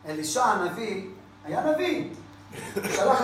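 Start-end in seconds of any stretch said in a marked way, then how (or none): none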